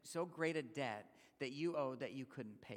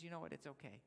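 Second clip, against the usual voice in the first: softer, tender release of the debt; gentle forgiveness; 4 semitones lower, 9 dB quieter, faster than usual. Retold or imitated. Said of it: "the master says".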